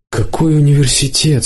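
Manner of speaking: usual speaking voice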